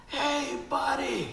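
high called-out greeting across a crowd; bright friendly shout of hey buddy; calling out. Retold or imitated. Guy calling out across speedway.

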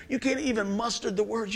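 ordinary delivery

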